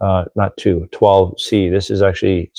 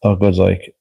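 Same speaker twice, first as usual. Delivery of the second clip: ordinary talk, nothing marked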